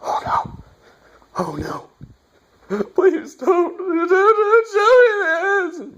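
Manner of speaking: whispered then high, slow sobbing wail; mock crying plea. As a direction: beg in hammed-up sobs, rising to a wail. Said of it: "I'm like".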